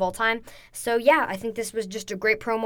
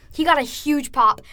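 ordinary talk

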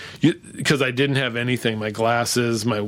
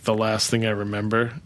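usual speaking voice

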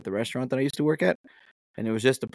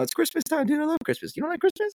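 very high, squeaky put-on voice; gushing mock enthusiasm. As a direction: squeal it in playful falsetto. Teasing friend with Christmas enthusiasm.

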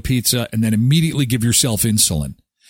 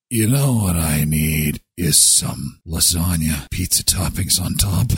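impersonated smoker; 9 semitones lower than usual. Acted. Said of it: "Sounds like somebody who is doing this. Then it's like".

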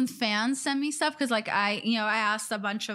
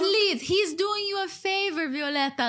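pleading mom; 7 semitones higher than usual. Retold or imitated. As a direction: beg her daughter gently to let him go. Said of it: "my mom was like".

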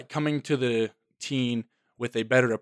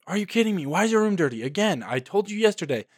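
much higher, exasperated scolding; angry parent; 7 semitones higher, faster than usual. Retold or imitated. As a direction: snap at them like an angry parent. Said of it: "someone came at me in anger".

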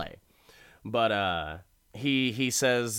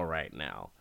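much softer, lower repeat of right now; soft echo; 9 semitones lower, 9 dB quieter than usual. Shown as a demonstration. Recapping the deal starting, trailing off quietly. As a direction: let right now fall away to a murmur.

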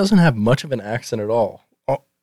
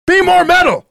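much higher barked order; mock angry dad; 3 dB louder than usual. Father barking a last order at Vinny, in a much higher mock-angry voice. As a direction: bark it like a fed-up sitcom dad.

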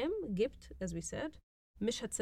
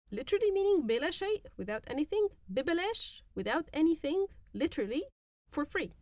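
emphatic teaching; 7 semitones higher, 4 dB louder than usual. Shown as a demonstration. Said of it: "teacher stresses literal meaning".

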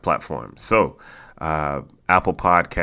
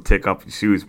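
ordinary talk

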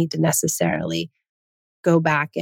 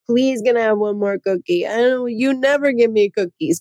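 mock whiny kid begging for cookies, a bit higher; whiny child; 4 semitones higher than usual. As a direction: imitate a child whining for a cookie. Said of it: "they're whining".

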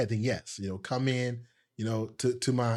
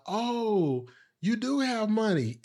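high-pitched imitation of an impressed kid; put-on high, amazed voice; mock amazement; 9 semitones higher, slower than usual. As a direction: play a kid marvelling, pitched way up. Thief kid feigns amazement.

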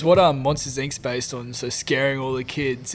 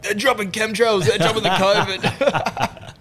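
slow, higher, hammed-up alarm; mocking conspiracy crowd; 6 semitones higher, 4 dB louder, much slower than usual. Imitating the Melbourne lockdown crowd, mock alarm. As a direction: ham up the panicked believers.

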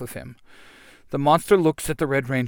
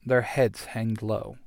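usual speaking voice

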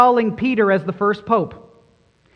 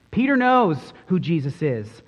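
ordinary talk

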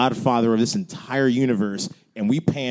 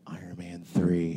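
softer, slow hushed aside; whisper; 8 semitones lower, 9 dB quieter, slower than usual. Retold or imitated. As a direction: whisper it to the neighbour. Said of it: "I heard some whisper".

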